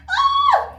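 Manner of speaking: sudden high, loud cry of frustration; frustrated shriek. Acted. Vlogger shrieking to viewers about being sent home. Frustrated outburst after the laugh.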